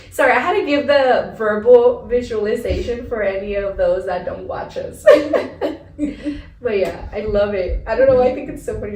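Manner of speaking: loud, giggling apology; cracking up while explaining; laughing. Real, not acted.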